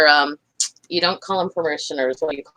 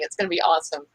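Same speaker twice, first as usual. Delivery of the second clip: usual speaking voice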